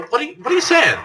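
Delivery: very high, loud disbelieving squeak; shocked squeak. Acted. Hajime hearing Nagito's clone claim, squeaking in shock. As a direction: shriek the question.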